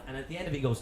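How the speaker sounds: ordinary delivery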